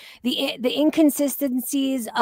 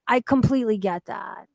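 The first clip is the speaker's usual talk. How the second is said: usual speaking voice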